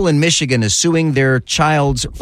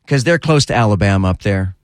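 normal talking voice

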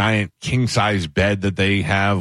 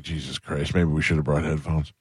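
muttered aside; 5 semitones lower, 5 dB quieter than usual. Retold or imitated. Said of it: "and I was like".

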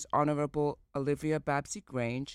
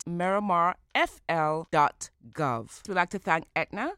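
normal talking voice

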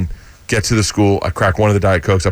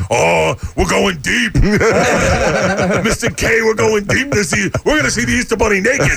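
Joey Diaz impression; 10 semitones higher than usual. Son retelling party, imitating Joey Diaz giddily. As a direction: ham up a giddy high guy. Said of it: "Joey starts going".

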